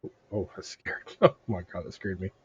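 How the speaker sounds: ordinary delivery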